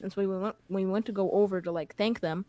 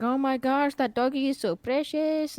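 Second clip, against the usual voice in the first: higher, slow, cooing over the dog; gushing mom; 6 semitones higher, slower than usual. Retold or imitated. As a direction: gush like a mom who just saw a puppy.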